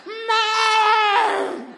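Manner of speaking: put-on high, loud child's wail; child's shout. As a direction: scream for mom like a tantrum child. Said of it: "le gritaba". (grito agudo de niño berrinchudo)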